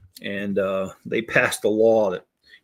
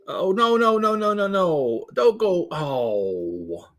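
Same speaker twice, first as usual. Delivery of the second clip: alarmed oh no; 7 semitones higher, slower than usual. Real, not acted. Screen content disappears.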